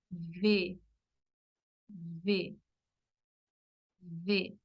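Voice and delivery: V held with teeth on lip, buzzing; Portuguese v. Shown as a demonstration. Teacher demonstrating the Portuguese v sound. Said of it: "Así es el sonido".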